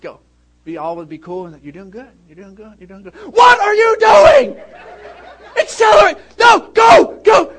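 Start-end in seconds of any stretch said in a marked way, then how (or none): 1.6-3.1 soft, gentle encouragement from the passenger seat; mock calm coaching. Son imitating his dad's driving lessons, soft mock praise. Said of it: "impersonation of me". 3.1-7.5 yelled much higher and louder, slow then frantic; mock panicked shout. Son imitating dad yelling during driving lesson, frantic shouting. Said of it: "impersonation of me".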